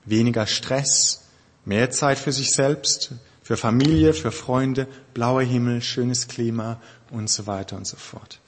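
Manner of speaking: usual speaking voice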